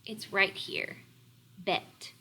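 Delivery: usual speaking voice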